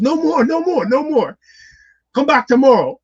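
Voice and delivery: high, loud mock yell; retold shout. Passport lady turning away a waiting applicant, imitated shout. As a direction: imitate her shutting everyone down at full voice.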